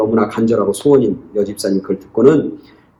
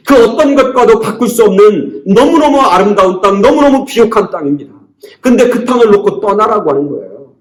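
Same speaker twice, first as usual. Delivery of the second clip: loud, driving sermon climax, voice raised high; impassioned preaching; 8 semitones higher, 5 dB louder than usual. Acted. Preacher stirring the congregation over Isaac's injustice, fervent raised preaching. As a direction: preach it hard, pressing each question on the flock.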